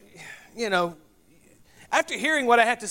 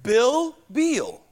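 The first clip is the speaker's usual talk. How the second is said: Minnesota "Bill Beal", the two names kept distinct; non-Southern "Bill Beal": short i, then long ee; non-Southern accent; 4 semitones higher than usual. Retold or imitated. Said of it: "she's from Minnesota".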